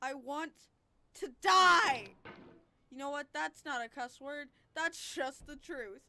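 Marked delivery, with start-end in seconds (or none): 0.1-2.1 despairing yell. Real, not acted. Despair at the game.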